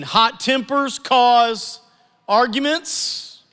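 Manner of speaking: "Hot tempers cause arguments" said very slowly, word by word; slow emphatic reading. Shown as a demonstration. Pastor reading a proverb to the congregation, very slow. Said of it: "reading scripture for emphasis".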